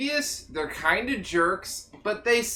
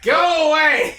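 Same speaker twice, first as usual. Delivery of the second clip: loud, high shout; 6 semitones higher, 7 dB louder than usual. Acted. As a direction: yell it out. Shouting at the character.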